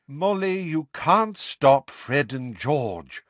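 weary, slow voice. A narrator's reading. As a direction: say it wearily. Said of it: "said Mister Weasley wearily".

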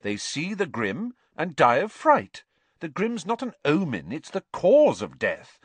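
high, emphatic know-it-all; superior. A narrator's reading. Girl explaining Grims to friend, superior tone. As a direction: lecture with smug certainty. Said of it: "in a superior tone".

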